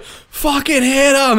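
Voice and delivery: very high, loud, slow imitated shout; coach's yelled order. Coach ordering his player into a fight, frantic yell. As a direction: holler it like a coach from the bench.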